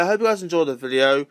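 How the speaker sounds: usual speaking voice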